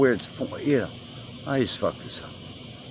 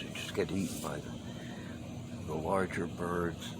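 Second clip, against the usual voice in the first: nearly whispered, slow and soft; hushed aside; 3 semitones lower, 14 dB quieter than usual. Real, not acted. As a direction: drop the voice to a dark hush. Grim aside about birds.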